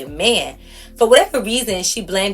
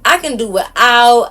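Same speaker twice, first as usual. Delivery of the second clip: higher and louder sassy "I'm good"; dismissive brush-off; 7 semitones higher, 6 dB louder than usual. Retold or imitated. Telling the whiny guy off, loud sassy dismissal. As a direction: wave him off, sassy and loud.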